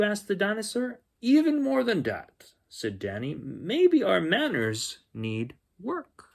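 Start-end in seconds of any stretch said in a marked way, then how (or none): 1.2-2.5 put-on high, excited little-boy voice; Danny's voice. Danny telling the dinosaur about kings, high and eager. 3.3-6.3 higher and slow, thoughtful; Danny musing. Acted. Danny telling the dinosaur they need practice, higher and slow.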